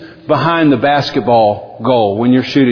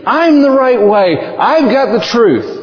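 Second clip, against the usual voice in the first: clamouring crowd voice; 9 semitones higher than usual. Retold or imitated. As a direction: shout over everyone for attention. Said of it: "all of these voices out there are waving their hands and they're saying".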